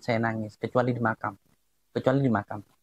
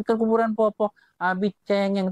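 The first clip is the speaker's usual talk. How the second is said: higher-pitched imitation of a complaining child; protesting child; 9 semitones higher than usual. Child scolds crying dad at the grave, imitated protesting child. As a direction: imitate a kid's indignant protest. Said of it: "anak saya protes tuh".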